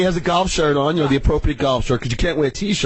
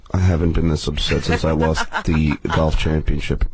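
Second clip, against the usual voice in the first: lower, slow mock of a sulking loser; sulking Tom; 11 semitones lower, slower than usual. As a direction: drop low and drag it out like a sore loser. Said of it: "he's like".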